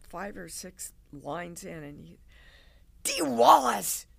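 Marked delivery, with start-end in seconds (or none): 3.0-4.1 loud, slowed rebuke from a strict acting coach; stern teacher shout. Acting teacher stopping Dee mid-scene, loud scolding shout. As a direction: bark her name to stop the scene cold.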